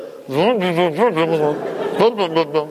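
imitated garbled speech without words; muffled womb noise. What the baby hears in the womb, muffled babble. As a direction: make muffled, wordless talk sounds. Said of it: "that's all the kid hears".

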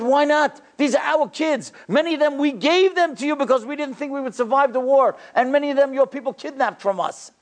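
high, urgent remonstration; impassioned protest. Retold or imitated. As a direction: argue back in outrage, pitched high throughout. Rabbi protesting the Pope's refusal.

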